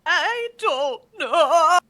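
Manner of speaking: high, slow, teary-laughing gnome wail; half laugh, half sob like a flustered apprentice; laughing-crying gnome. Acted. Gnome apprentice admitting she knows nothing, high, laughing through tears.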